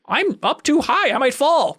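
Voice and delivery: put-on high-pitched, drawn-out kid's whine; kid stuck up high. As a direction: imitate a kid calling down from too high up. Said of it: "some kid up there, and he's like".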